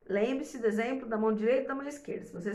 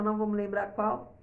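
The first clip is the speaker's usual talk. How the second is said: ordinary talk, nothing marked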